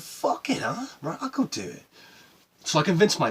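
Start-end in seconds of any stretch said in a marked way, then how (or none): 0.0-1.8 high-pitched burst of bravado; acted resolve. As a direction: replay a sudden reckless decision. Acts out his bold decision.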